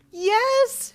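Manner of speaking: high, loud, elated scream; screamed yes. A narrator's reading. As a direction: scream it with overwhelming excitement. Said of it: "she screamed".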